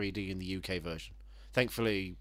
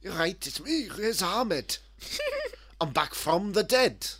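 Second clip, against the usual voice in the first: Welsh Riz Ahmed; 8 semitones higher, slower than usual. Retold or imitated. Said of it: "everyone's Welsh in the version".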